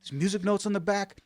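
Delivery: high-pitched imitation of amazed disbelief; mock astonishment, pitched well up; losing it. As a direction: retell it as a man starting to flip out. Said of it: "start losing his shit".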